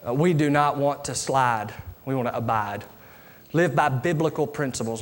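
earnest, unhurried pastoral prayer; closing prayer. Real, not acted. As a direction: lead the congregation gently in prayer.

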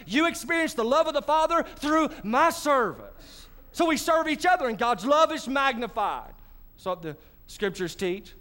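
ordinary talk, nothing marked

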